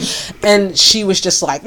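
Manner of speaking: usual speaking voice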